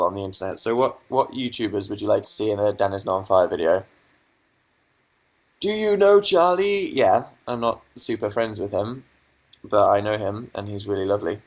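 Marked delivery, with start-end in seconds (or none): 5.6-6.9 high drawn-out read. Retold or imitated. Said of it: "reading chat question".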